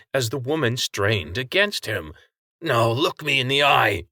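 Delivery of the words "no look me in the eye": mild shout. A narrator's reading. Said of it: "she shouted".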